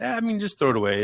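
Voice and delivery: mock careless shrug, high and fast; nonchalant. Old man shrugging off a confiscated machete, mock nonchalant. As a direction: toss it off like it's nothing. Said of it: "just be nonchalant about".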